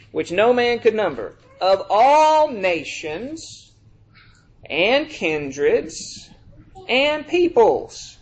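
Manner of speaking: slow, drawn-out solemn reading; scripture reading. Acted. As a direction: stretch every word of the verse. Reading Revelation aloud.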